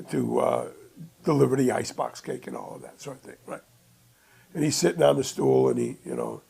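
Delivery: usual speaking voice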